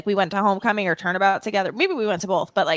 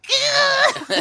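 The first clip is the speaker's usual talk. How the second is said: imitated subwoofer noise. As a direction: mimic the rattle of subwoofers. Imitates the subwoofers heard from the driveway.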